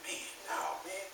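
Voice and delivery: breathy unvoiced aside; hushed disbelieving aside; whisper. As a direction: drop to a whisper. Aside to audience.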